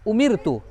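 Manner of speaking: उमिर तू with a fine, thin R; thin light R. Shown as a demonstration. Teacher repeating for pupils to copy, thin R. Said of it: "बारीक यानी पतली करके".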